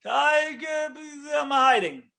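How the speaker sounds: Adam, caught by God, yelps his excuse; defensive Adam. Retold or imitated. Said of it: "Adam gets defensive".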